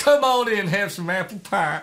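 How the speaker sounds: mock Southern hospitality voice; Southern American accent. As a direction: play a Southern host offering pie. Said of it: "You're doing the full range of accents".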